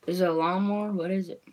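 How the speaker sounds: ordinary talk, nothing marked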